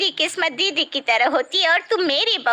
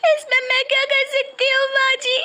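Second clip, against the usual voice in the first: high, louder tearful complaint; 12 semitones higher, 3 dB louder than usual. Acted. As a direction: plead helplessly through tears. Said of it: "तू पहले चुप हो जा". (रोती हुई बहू की बेबसी)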